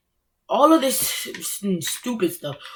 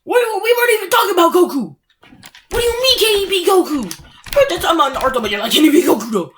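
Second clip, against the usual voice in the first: higher, disbelieving what-are-you-on-about; baffled outburst; 7 semitones higher, 3 dB louder, faster than usual. Retold or imitated. Ranting at fan who keeps asking about Goku, disbelieving. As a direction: pitch shoots up in confused disbelief. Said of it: "I'm like".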